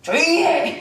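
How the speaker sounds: high, sharp acted parental yell; mock cross parent, pitched high; angry at home. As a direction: snap at the kids to hush. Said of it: "목사도 집에서 화나면".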